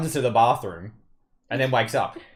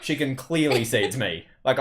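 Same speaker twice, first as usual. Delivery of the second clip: ordinary delivery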